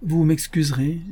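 mildly unctuous courtesy; honeyed politeness. A narrator's reading. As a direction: sweet-talk the stranger lightly. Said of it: "dit Holmes mielleusement".

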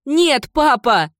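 high, loud, drawn-out protest; crying out. Acted. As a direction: shout it desperately to a father. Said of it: "закричала Кендалл". (отчаянный крик)

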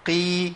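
"قي" with the qaf pulled slightly lighter; qaf with kasra. Shown as a demonstration. Teacher showing learners the kasra qaf, clearly. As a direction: let "قي" thin out a little. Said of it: "القاف المكسورة".

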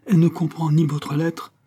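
high-pitched, bewildered protest; growing stupefaction. A narrator's reading. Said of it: "dont la stupeur allait croissant".

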